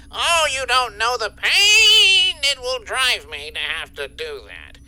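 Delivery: mock anguish. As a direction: pretend it pains you terribly. Boss fakes regret at docking pay.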